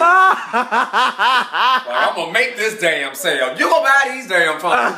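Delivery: high, slow, loud voice of the case; talking phone case. Retold or imitated. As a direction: give the case a pushy voice, drawn out. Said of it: "The case was like".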